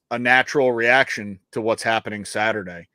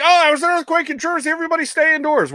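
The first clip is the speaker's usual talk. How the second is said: much higher, fast mocking voice; mock alert announcement; faster than usual. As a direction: parody the late official warning. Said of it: "they're like".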